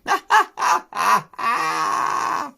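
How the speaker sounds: loud, high mocking lamb laugh; a lamb jeering with a bleat.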